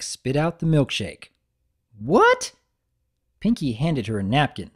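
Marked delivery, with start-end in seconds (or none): 2.0-2.5 very high, loud startled cry; shocked what. Acted. Applejack spitting out the milkshake in shock. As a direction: yelp it in disbelief.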